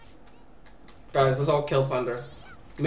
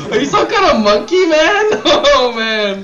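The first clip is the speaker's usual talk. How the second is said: laughing; 11 semitones higher than usual. Real, not acted. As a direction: laugh through the words. Laughing at friend's fail.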